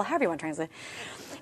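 ordinary delivery

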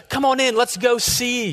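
high-pitched British mom imitation; British accent. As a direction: chirp it as a British hostess. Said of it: "she was British".

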